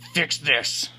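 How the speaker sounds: high, loud yell at the mirror. A narrator's reading. Man yells at his reflection in a loud rage. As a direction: bark it at your own face. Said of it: "I yell at my reflection".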